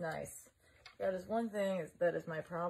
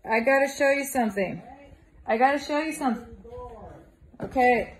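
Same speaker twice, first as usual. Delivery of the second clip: loud, raised-pitch call through a door; 3 semitones higher, 9 dB louder than usual. Real, not acted. Vlogger at Carlos's door with something to show, calling out. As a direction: holler to get someone's attention.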